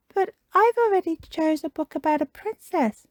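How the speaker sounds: put-on high child's objection; Peppa Pig. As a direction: protest in a little piglet voice. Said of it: "says Peppa".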